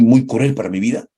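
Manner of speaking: usual speaking voice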